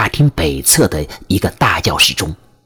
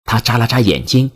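usual speaking voice

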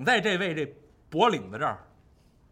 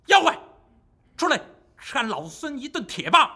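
challenging shout; 4 semitones higher than usual. Acted. Wukong calls out at the cave.

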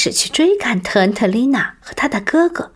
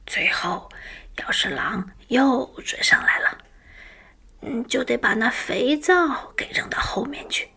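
hushed grandmother; 4 semitones higher than usual. Acted. Grandmother's whispered instructions continue.

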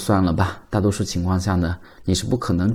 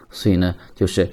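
usual speaking voice

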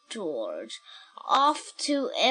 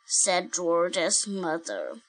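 put-on reading to a toddler; 2 semitones lower than usual. Reads picture book aloud.

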